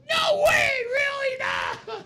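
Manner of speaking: very high, loud fanboy shriek; excited yell. Retold or imitated. Fan friend shrieking in disbelief at the invitation. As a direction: scream it in total disbelief. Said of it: "He's like".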